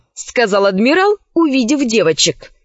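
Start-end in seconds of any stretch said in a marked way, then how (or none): none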